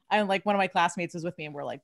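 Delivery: normal talking voice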